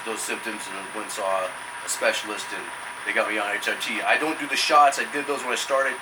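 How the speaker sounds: usual speaking voice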